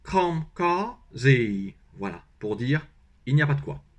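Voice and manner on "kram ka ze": northern Vietnamese kram ka ze, very slow; slow northern Vietnamese, ending on ze; northern Vietnamese accent. Shown as a demonstration. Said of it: "Accent du nord".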